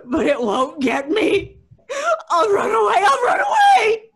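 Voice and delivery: sobbing, rising to a shout; panicked sobbing shout; crying in panic. Acted. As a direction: cry out and flee. Girl breaks into crying flight.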